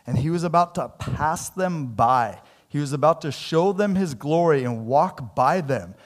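ordinary talk, nothing marked

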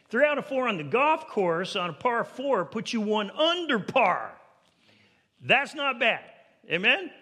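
high, loud, punchy pulpit argument; full-force preaching. Real, not acted. Preacher gives church sports examples, full-force preaching. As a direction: drive each sports example home emphatically.

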